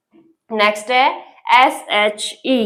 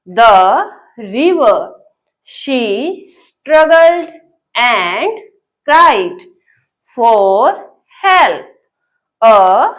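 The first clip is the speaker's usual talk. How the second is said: each word read slowly with pauses between; teacher reading one word at a time; 2 semitones lower, much slower than usual. Shown as a demonstration. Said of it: "कोशिश करेंगे एक बार फिर से".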